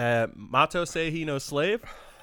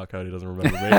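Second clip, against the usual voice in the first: mild sad; 7 semitones lower, much faster than usual. Retold or imitated. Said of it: "I was like".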